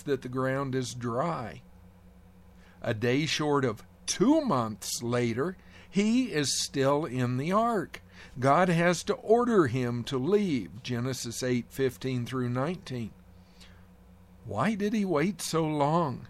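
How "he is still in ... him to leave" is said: usual speaking voice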